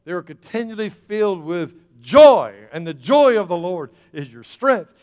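"joy" punched very loud and high; emphasis on joy. Real, not acted. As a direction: hit the word "joy" hard each time. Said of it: "I had to emphasize this word joy".

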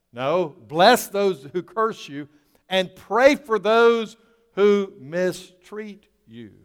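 slow, loud, rising preacher cry; preaching at full force. Acted. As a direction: drive the command home to the congregation.

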